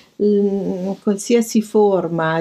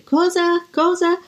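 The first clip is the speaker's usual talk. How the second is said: deaf man asking; 12 semitones higher, slower than usual. Retold or imitated. Deaf critic asking back.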